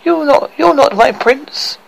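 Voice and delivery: put-on high, shrill-edged cries of a terrified girl; frightened scream. A narrator's reading. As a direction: scream it at the nasty dwarfs, high and panicked.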